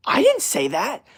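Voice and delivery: high, drawn-out incredulous denial; indignant protest. Retold or imitated. Misquoted man defending his words, indignant protest. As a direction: protest in acted disbelief, slowly.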